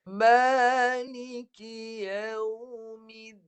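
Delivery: Maliki yaumiddin drawn out very slowly, nun hummed in the nose; slow recitation. Shown as a demonstration. Quran teacher reading slowly to show nun. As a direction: stretch every syllable of Maliki yaumiddin slowly, high. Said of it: "Ni saya baca lambat".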